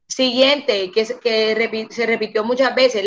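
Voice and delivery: ordinary talk, nothing marked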